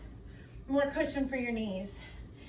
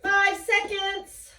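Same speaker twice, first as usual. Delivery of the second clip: pumped countdown; 7 semitones higher, 5 dB louder, much slower than usual. Real, not acted. Coach counting down hard interval.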